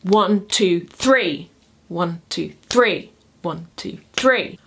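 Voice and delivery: rising triple count landing on "three"; two light beats, then hit "three"; anapestic stress. Shown as a demonstration. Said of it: "two unstressed syllables followed by a stressed".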